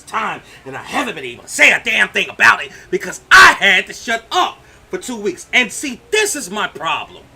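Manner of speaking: shouting him down in real anger; angry shouting. Real, not acted. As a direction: let it all out, loud and furious. Angry rant at Flip Flop.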